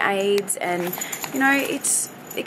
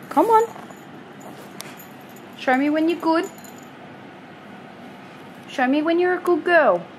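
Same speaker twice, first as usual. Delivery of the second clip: pet talk; 6 semitones higher than usual. Real, not acted. Owner coaxing her dog to sit, high and sing-song. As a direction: sweet-talk the dog into sitting.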